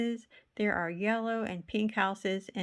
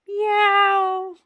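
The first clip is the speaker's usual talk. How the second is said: a much higher, much louder pained cat yowl; yowling meow; 12 semitones higher, 12 dB louder than usual. Acted. Cat crying out under Mister Pine's foot, loud yowl. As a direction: yowl like a cat whose paw got stepped on.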